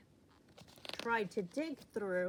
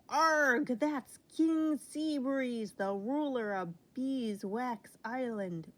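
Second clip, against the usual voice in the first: ordinary delivery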